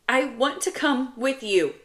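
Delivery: upset little brother's plea; pleading child. Acted. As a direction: beg like a teary kid. Tearful child pleads to come along.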